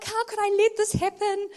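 high, loud self-blaming wail; hammed-up distress; mock distraught. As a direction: lament it in comic despair. Acted self-blame over stolen car.

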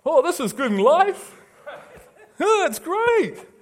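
very high, loud gushing; mock delight. Preacher voicing people fooled about what brings life, mock delight. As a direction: gush with hammed-up joy. Mocking the self-deceived sinner's delight.

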